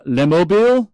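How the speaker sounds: "les mobiles multifunction" in a hammed-up French accent; mock French accent. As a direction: put on a French accent for the term. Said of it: "pardon my French accent".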